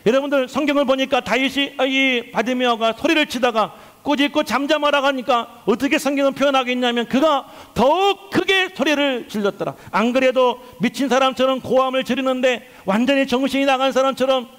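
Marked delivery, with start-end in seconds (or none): none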